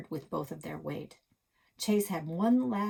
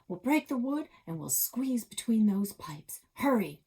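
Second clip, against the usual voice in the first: urgent push; 5 semitones higher, 4 dB louder than usual. Acted. Chase urging escape.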